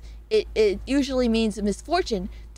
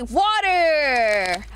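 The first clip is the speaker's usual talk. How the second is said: excited. Real, not acted. Cheering for her drink.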